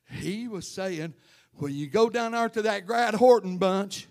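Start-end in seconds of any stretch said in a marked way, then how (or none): 1.5-4.1 loud mock shout of the preacher; louder, higher imitation of a yelling preacher; retold scream. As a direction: call it out loud like the man did. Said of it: "he was screaming it out there".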